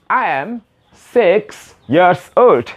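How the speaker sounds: very slow, each word separated; drawn-out teaching example; slow model sentence. Shown as a demonstration. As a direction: model it slowly for beginners. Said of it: "teacher model sentence".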